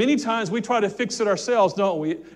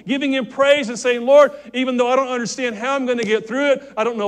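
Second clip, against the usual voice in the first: higher and louder, rising to a peak; preaching climax; 4 semitones higher, 5 dB louder than usual. Acted. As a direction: build the plea toward a shout.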